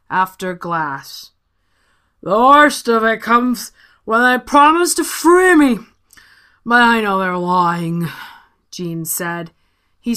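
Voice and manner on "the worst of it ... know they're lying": tipsy genie. Acted.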